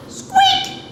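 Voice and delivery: tiny, very high squeak; extremely high imitated squeak; mouse squeak. A narrator's reading. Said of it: "said the mouse".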